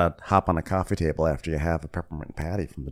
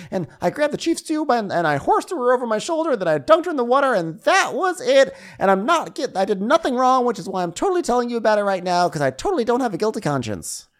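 much higher, louder guilty-guy voice; mock Eddie; 4 dB louder than usual. Eddie telling his girlfriend about the hot tub, high mocking voice. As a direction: ham up a nervous boyfriend, high and fast.

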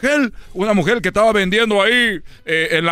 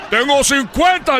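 a higher, slow imitation of dad; drawn-out proud-father voice, lightly done; dad's voice; 6 semitones higher, slower than usual. Boasting father tells the vendor his age, slow and higher. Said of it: "Dijo, en la voz de mi papá".

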